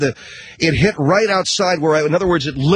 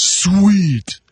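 put-on higher, louder stoked teen; teen son's delight; 6 semitones higher, 4 dB louder than usual. Son allowed to drive the boat, a higher stoked "sweet". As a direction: toss it off like a thrilled teenager. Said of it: "He goes".

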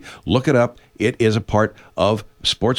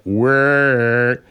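long, level droning whir; imitated firework noise; 3 dB louder than usual. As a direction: hold out a mimicked whirring noise. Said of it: "you'll hear, like".

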